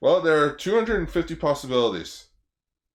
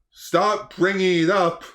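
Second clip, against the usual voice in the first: usual speaking voice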